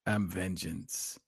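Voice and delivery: slow, softened Batman voice; Robert Pattinson Batman. Acted. Joining in on the co-host's impression, sad Batman. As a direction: draw it out quietly like Batman. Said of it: "Robert Pattinson is so sad".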